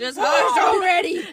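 high, loud, half-laughing cry; mock exasperated shout. Toddler fed up with yelling sister, mock shout.

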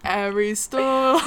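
slow, drawn-out mock English accent. Co-host echoing the star's name, English accent. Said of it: "like, an English accent".

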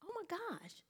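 frantic hushed inner monologue; inner thoughts. Retold or imitated. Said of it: "I'm like".

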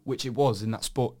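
normal talking voice

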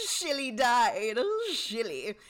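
slow, slurred drunk impression; mock drunk mother. Host imitating the tipsy mother, slow mock-drunk slur. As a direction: slur it heavily, stretching the words. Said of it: "read her performance as shit-faced".

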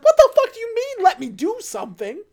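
high, loud exasperated shout that eases off; hammed-up fury at someone blocking CPR; mock angry yell. Brian yelling at Letty.

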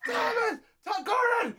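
very high-pitched imitation of yelling; mock yelling. Host imitating co-host yelling while muted, high mock yell. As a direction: laughingly mimic someone yelling into a dead mic. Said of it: "You were yelling it".

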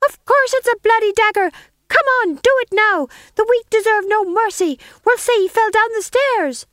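high, loud taunting wife; scheming wife voice. Acted. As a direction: egg him on in a shrill stage voice. Gumridge as Lady Macbeth.

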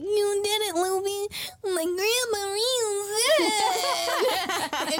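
high, wailing, drawn-out blubber; mock tearful joy. Acting tearful Apis.

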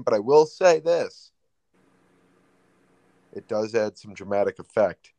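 long dramatic pause after "this". Real, not acted. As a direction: build to "this", pause, then drop back casually. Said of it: "just like that long pause".

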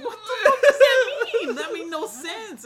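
high, loud, slow laughing outrage; cracking up at something absurd; laughing disbelief. Retold or imitated. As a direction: squeal it through laughter. Retold own baffled reaction.

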